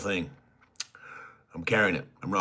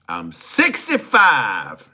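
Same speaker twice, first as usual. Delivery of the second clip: mock old voice; 9 dB louder, much slower than usual. Mock old age answer.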